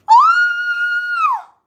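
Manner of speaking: piercing high shriek of real surprise; sudden scream. Real, not acted. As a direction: let out a startled scream. Sudden real shock on stream.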